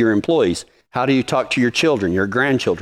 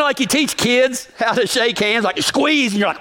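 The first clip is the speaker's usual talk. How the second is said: ordinary delivery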